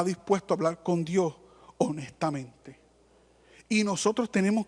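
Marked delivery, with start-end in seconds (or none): 1.8-2.8 susurro. Real, not acted. Subraya hablar honesto con Dios.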